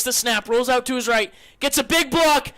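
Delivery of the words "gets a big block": rising to a screaming call of the run; excited play-by-play. Real, not acted. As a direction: yell every yard as he breaks free.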